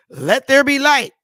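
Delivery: high and loud, booming decree; mock godlike proclamation. Mocking stoners' claimed powers to viewers, booming mock decree. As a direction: proclaim it like a god creating the world.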